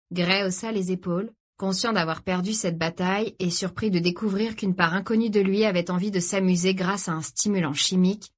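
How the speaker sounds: plain reading voice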